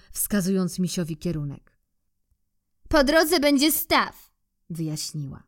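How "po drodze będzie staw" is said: squirrel. Acted. Squirrel voiced in story.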